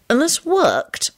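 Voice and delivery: high, slow, careful question; polite query. A narrator's reading. Sludge asking whether the oath trick worked, polite. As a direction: ask slowly, with polite doubt. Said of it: "Sludge asked politely".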